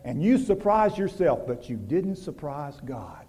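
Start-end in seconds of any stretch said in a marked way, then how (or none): 1.5-3.3 whispered, low and slow; a secret whispered to the congregation. Acted. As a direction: let it drop to a hushed whisper. Preacher's hushed point on God.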